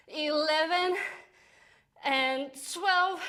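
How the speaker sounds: strained voice, legs trembling; shaking with effort. Real, not acted. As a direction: push the count out through shaking legs. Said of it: "my legs are shaking".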